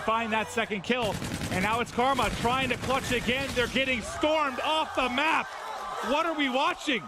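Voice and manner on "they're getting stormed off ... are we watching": excited caster call. Real, not acted. Reacting to a wipe.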